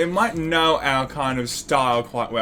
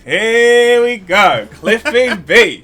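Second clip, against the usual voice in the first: slow, loud mock ring announcer; drawn-out boxing hype call; 6 semitones higher, 7 dB louder, much slower than usual.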